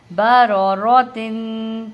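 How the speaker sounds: slow, loud, chanted word; read-along model. Shown as a demonstration. Teacher asks learners to read along, slow loud chanting. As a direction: lead learners through it, holding the last syllable. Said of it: "میرے ساتھ ساتھ پڑھتے جائیے".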